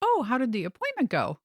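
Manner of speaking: cheery sister. Retold or imitated. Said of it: "the sister calls, and she says".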